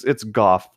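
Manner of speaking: Goff said like golf without the l; patiently correcting a name. Shown as a demonstration. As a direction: spell it out, Goff rhyming with off. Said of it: "Just think golf, but, like".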